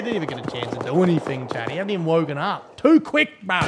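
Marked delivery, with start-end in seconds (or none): 2.8-3.6 high, loud complaint at a departing viewer; indignant shout. Real, not acted. Streamer yells at a viewer who left too fast. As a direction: yell it like they bailed too soon.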